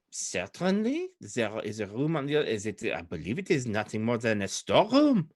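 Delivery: put-on French-accented lady thinking aloud; Old Adari accent, French-like. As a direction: explain casually in a French accent. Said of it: "closer to Old Adari".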